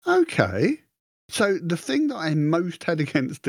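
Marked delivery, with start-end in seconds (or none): 0.1-0.8 mock surprise. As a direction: squeal out a happy disbelief. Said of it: "And I went".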